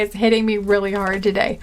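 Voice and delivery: normal talking voice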